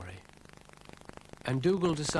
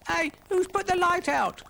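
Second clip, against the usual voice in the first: very high, loud squeak; 8 dB louder than usual. Acted. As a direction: squeak it out in alarm. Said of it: "squeaked Brian".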